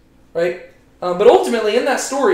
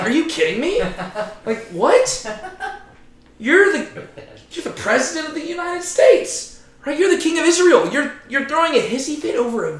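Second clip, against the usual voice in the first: incredulous laugh; 8 semitones higher than usual. Real, not acted.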